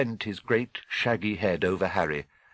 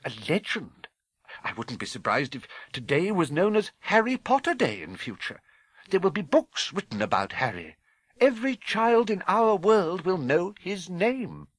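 higher-pitched, animated woman's character voice; excited stern professor; 9 semitones higher than usual. Acted. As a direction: rise high with emphatic conviction.

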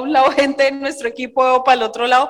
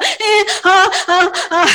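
loud hammed-up sobbing gasps, higher; mock sobbing; 5 semitones higher, 5 dB louder, slower than usual. Reliving crying at the seminar, loud mock sobs. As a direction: sob it out comically, louder. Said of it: "y yo ahí en la mitad".

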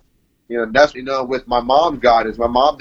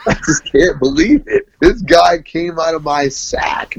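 laughing; 5 semitones higher than usual. Real, not acted. Laughing in disbelief about son.

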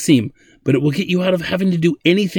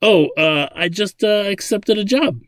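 hesitant, higher-pitched sheepish admission; 10 semitones higher than usual. A narrator's reading. Ladin admits to Zadeh he took a job, sheepish. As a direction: own up sheepishly, voice lifted and halting. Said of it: "he said sheepishly".

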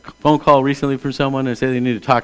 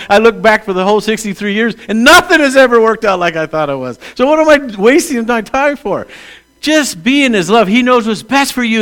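rising, louder preaching voice; 7 semitones higher, 4 dB louder than usual. Acted. Pastor urging the congregation, building to fervent preaching.

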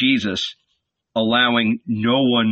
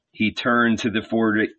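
plain reading voice

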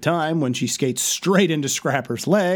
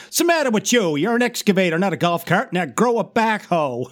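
scornful taunt; 6 semitones higher than usual. Retold or imitated. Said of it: "Mix has a great line".

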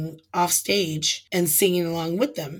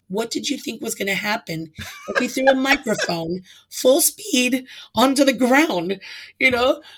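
higher, louder incredulous scolding; mock angry lecture; exasperated retort; 6 semitones higher, 5 dB louder than usual. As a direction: scold her in disbelief. Angry retort to drunk girl.

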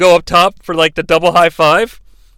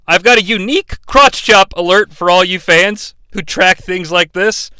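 normal talking voice

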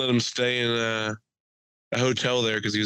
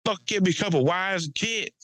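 fake Texas accent; 9 semitones higher than usual. Mocking tourists who get drunk and fake a Texas accent. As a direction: ham up a drunk Texan dreaming of a farm. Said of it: "fake a Texas accent".

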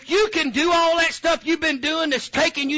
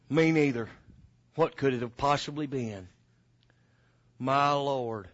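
much lower, softer mourner voice; low quiet mourner; 11 semitones lower, 7 dB quieter than usual. Retold or imitated. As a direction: mumble it low and solemn. Second mourner answers low.

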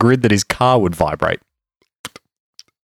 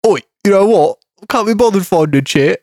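put-on British accent; 9 semitones higher than usual. Racing driver in a hammed-up British accent. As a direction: ham up a British lad's Oi. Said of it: "I'll do it in a British accent".

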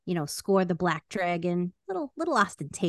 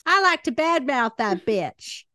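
punchy, louder, brazen badmouthing; brash trash talk; 2 semitones higher, 5 dB louder, slower than usual. Acting out the crude way of saying it, brash. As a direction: lay into someone with relish.

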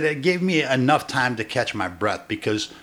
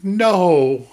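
mock dismayed cry; 6 semitones higher, 5 dB louder than usual. Acted shocked reaction to the ending.

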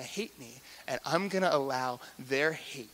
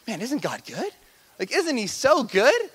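excited praise; 9 semitones higher, 8 dB louder than usual. Retold or imitated. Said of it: "we just get to say, like".

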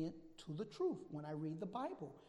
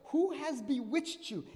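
loud, high-pitched reproachful question; challenging rebuke; 6 semitones higher, 8 dB louder than usual. Acted. Paul confronting the misled Galatians, loud challenge. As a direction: demand an answer from them, voice raised. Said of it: "he says to them".

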